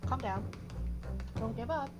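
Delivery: ordinary talk, nothing marked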